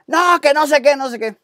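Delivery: high dismissive "no, que no sé qué"; mock stubborn protest. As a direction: brush it off like stubborn Peter. Mocking Peter's stubborn protest.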